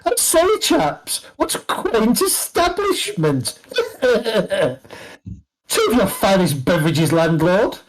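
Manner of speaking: high-pitched posh toff with a laugh; posh, quaint voice. Acted. Posh aristocrat arriving at the tavern and ordering from the landlord, quaint high voice. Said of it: "you hear this rather posh, quaint voice".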